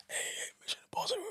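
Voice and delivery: breathy stage whisper of a star's film title. Retold or imitated. Fan whispering at Tom Cruise on the red carpet, awed hush. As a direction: hiss it under your breath like a starstruck fan.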